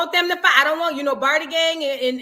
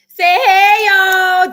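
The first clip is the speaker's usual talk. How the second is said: louder and higher, calling to the viewers; loud cheerful call; 5 semitones higher, 13 dB louder, much slower than usual. Real, not acted. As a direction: sing it out to the audience. Introducing kid to viewers.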